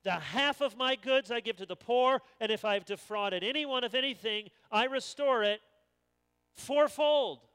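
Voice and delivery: loud, high proclamation of Zacchaeus; loud vow. Acted. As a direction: proclaim it boldly to the Lord. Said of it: "Zacchaeus stood and said to the Lord".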